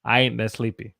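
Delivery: "I ain't that sleepy" run together, Southern style; quick, slurred-together Southern dialect; Southern accent. Shown as a demonstration. Teacher shows how it really sounded, connected Southern accent. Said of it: "it sounded like".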